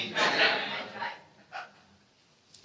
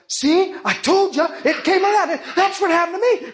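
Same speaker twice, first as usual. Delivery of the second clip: high-pitched imitation of an excited witness; excited vouching; 9 semitones higher than usual. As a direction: act out a thrilled "told you so". Healed man excitedly vouching to others.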